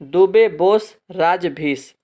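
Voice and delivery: the mnemonic Dubey बोस राजभीस spelled out slowly for students; slow dictation. Shown as a demonstration. Teacher giving students the trick, said slowly. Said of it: "तो trick क्या है".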